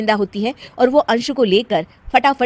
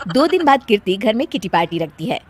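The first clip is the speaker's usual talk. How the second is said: ordinary delivery, nothing marked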